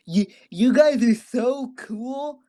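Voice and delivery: high, slow, dreamy doped-up gushing; drugged gushing. Retold or imitated. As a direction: gush at the nurses like you're floating on anesthesia. Drugged praise for the nurses.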